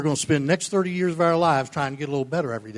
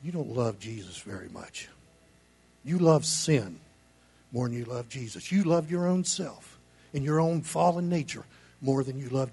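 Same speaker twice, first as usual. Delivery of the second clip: quiet rebuke; 3 semitones lower, 6 dB quieter than usual. Retold or imitated. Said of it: "what I tell them is".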